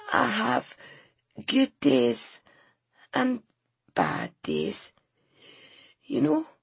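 weak, breaking voice; frail sick woman. A narrator's reading. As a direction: push through pain to speak.

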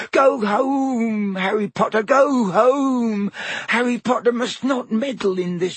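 high, tearful, rising to a plea; crying elf warning. Acted. Elf urging Harry to leave, tearful. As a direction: beg him through tears to go.

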